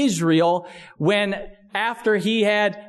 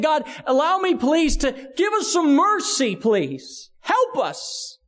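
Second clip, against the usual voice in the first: pleading; 7 semitones higher than usual. Retold or imitated. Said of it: "he's saying".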